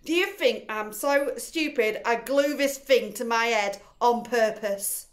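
loud furious retort, slowing at the end; angry shout. A narrator's reading. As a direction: bellow it, outraged.

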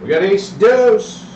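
high, slow drawn-out dice call; craps table caller. Real, not acted. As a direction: sing out the roll to the table. Dealer calls the roll.

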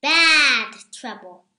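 plain reading voice

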